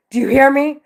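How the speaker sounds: escalated urgent demand. Real, not acted. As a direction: shout it to be heard. Repeats demand louder.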